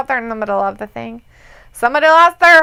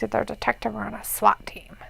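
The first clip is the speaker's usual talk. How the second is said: whispering; 3 semitones lower, 12 dB quieter than usual. Real, not acted. Reading chat quietly.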